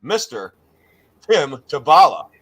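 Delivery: loud, punched guest announcement; big announcer reveal. Acted. Host welcoming the guest on air, big announcer reveal. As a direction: build from a held title to a loud welcome. Mock-formal guest introduction.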